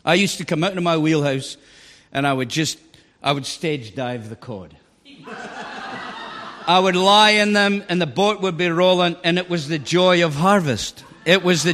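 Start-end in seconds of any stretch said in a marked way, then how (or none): 5.3-6.6 silent, airy laugh; breathy laugh. Real, not acted. Laughing at stage diving cod.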